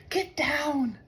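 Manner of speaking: high, soft hissed warning; a sharp little hiss of alarm. A narrator's reading. Mouse warns friends to hide, high soft hiss. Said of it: "hissed Mouse".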